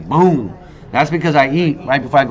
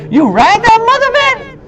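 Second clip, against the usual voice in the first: very high, loud sarcastic squeal; mocking retort; 5 dB louder than usual. To the old man, a high mocking squeal. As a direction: fire it back high and scornful. Mocking reply about getting home.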